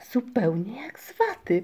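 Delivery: mild wonder; astonished. A narrator's reading. Migotka touches the little cloud, mildly astonished. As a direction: say it with a touch of surprise. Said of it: "powiedziała ze zdziwieniem".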